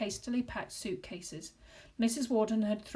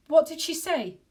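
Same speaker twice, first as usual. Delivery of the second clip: high, loud urgent question; anxious question; 8 semitones higher, 10 dB louder than usual. Acted. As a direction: ask it anxiously. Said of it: "Joe asked".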